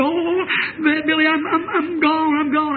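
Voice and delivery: high, distraught mock wail; imitated crying. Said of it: "she's just crying like that".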